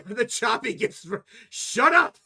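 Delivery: high, loud joking shout at the chat; mock yell. Real, not acted. Streamer yelling at chat about choppy video, loud mock shout. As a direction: shout them down, half laughing.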